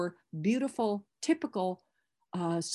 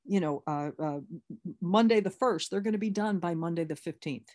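ordinary talk, nothing marked

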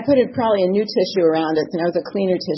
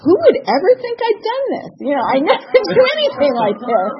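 high, laughing self-justification; a laughing mock-innocent thought; laughing inner voice; 10 semitones higher, 4 dB louder than usual. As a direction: giggle through the excuse. Retold self-talk with laughter.

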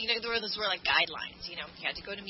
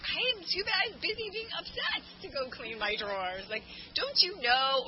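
mock indignant whine; 7 semitones higher, 3 dB louder than usual. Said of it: "And I was like".

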